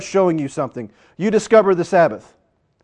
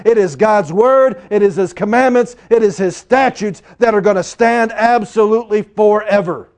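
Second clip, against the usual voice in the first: preacher's ringing declaration; forceful preaching; 5 semitones higher, 6 dB louder than usual. Acted. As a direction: proclaim it with full conviction.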